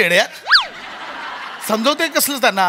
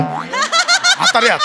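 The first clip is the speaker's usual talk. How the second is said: mocking squeal; much slower than usual. Son mocks father's age.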